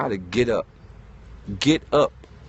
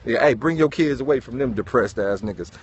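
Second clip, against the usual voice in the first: wary neighbor; 6 semitones lower, 3 dB quieter than usual. Retold or imitated.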